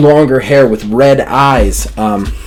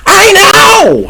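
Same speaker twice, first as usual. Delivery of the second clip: much higher, louder squeal; excited old lady shout; 6 dB louder than usual. Retold or imitated. Old Rebecca agreeing with Carl in a high shout. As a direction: shriek it with delight. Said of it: "Rebecca's like".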